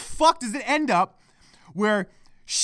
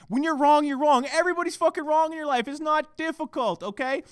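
ordinary talk

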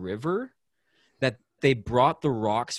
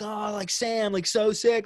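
buddies cheering; 8 semitones higher than usual. Retold or imitated. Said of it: "And they're like".

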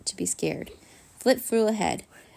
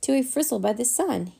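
higher-pitched cry of warning; alarmed cry; 5 semitones higher, 3 dB louder than usual. A narrator's reading. Flit warns Vlad he will burn, alarmed cry. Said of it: "cried Flit".

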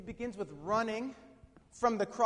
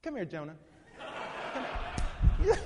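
playing God; 2 semitones higher, much faster than usual. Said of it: "I'd have been like".

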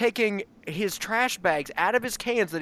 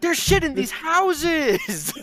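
mock exasperation; 9 semitones higher, 3 dB louder, slower than usual. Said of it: "And I say".

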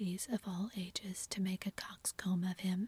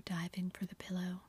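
ordinary delivery

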